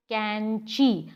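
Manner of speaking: कैंची said very slowly, syllable by syllable, falling at the end; drawn-out syllables. Shown as a demonstration. Therapist modelling a word for a child, कैंची said very slowly in syllables. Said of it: "इसी तरह मिसाल के तौर पे कैंची है".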